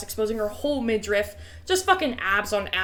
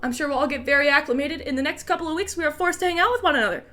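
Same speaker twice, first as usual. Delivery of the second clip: put-on Canadian accent; 5 semitones higher than usual. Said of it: "more Canadian she became as you just kept speaking".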